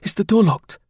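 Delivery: alarmed. A narrator's reading. Lady jumping up as strangers arrive, alarmed. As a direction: ask in sudden alarm. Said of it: "starting to her feet in alarm".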